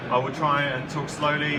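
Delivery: ordinary delivery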